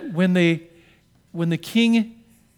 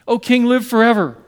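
calling out; 5 semitones higher, 8 dB louder than usual. Acted. Daniel calling up to the king from the den, loud. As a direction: proclaim it up out of the pit.

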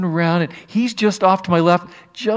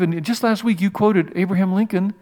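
usual speaking voice

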